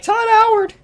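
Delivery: high-pitched ecstatic yell of the name; excited fan shout. Retold or imitated. Fan in the E3 crowd cheering Todd, shrieking with joy. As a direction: scream it like a starstruck fan at a show.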